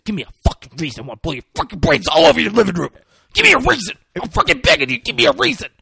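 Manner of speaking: high, loud, fast raging threat; a cop losing it, yelling a threat at the old man; out-of-control yelling. Retold or imitated. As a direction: scream it, begging for an excuse to shoot. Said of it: "this team is out of control".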